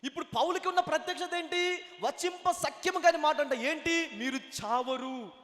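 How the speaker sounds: high, loud pulpit proclamation; ringing sermon climax; full-force preaching. Real, not acted. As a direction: call it out to the congregation, stretching the final words.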